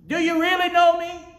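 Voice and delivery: high, shouted challenge; loud voice. Acted. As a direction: cry it out to the church people. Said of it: "in a loud voice".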